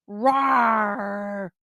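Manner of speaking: hammed-up little monster roar; mock roar. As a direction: growl it like a pretend beast. Voicing the turtle as a monster.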